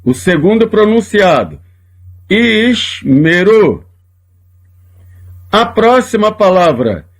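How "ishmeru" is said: Ishmeru said very slowly, ish-me-ru, first sheva silent; slow demo. Shown as a demonstration. Teacher models the word for students, very slowly. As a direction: draw out Ishmeru syllable by syllable. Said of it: "O primeiro é mudo, o segundo pronunciado".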